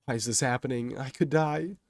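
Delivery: high, rising distress; panicked thought. A narrator's reading. Kei as his stomach drops, panicked thought. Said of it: "Kei is thinking as his eyes start to tear up".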